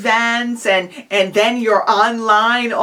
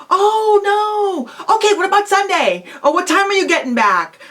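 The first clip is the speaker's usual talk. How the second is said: high-pitched "oh no", then quick counter-offers; upbeat demonstrated reply, pitched up; sympathetic persistence; 10 semitones higher than usual. Shown as a demonstration. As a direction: react with acted dismay, then coax eagerly. Acted reply to friend who can't come.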